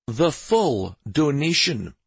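slow, emphatic confirmation; confirming. Acted. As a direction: weigh each word and settle it firmly. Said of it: "Stavin confirmed".

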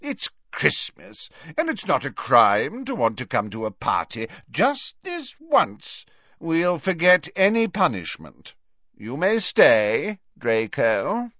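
pardon grandly with lingering, high-pitched words; Slughorn, indulgent. Acted. Voices Slughorn granting pardon.

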